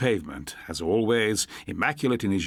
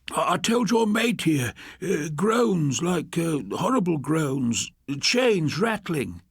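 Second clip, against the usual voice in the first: put-on high, slowing, jittery old man voice; a shaky ex-addict spooked by the noises; nervous tenant; 8 semitones higher, slower than usual. A narrator's reading. Nervous tenant describes ghost sounds.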